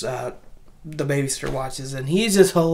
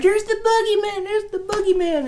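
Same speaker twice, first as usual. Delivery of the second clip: put-on much higher, panicky little-kid cry; scared kid. Kid warns babysitter about Michael, high panicky squeal. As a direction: squeal it like a frightened child. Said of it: "he's like".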